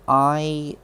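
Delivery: usual speaking voice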